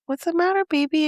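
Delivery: high, soft coaxing mom voice; tender concern. Retold or imitated. Mother asking her sad little one, tender and high.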